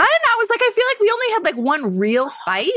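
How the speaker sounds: excited rant. Real, not acted. Excited complaint.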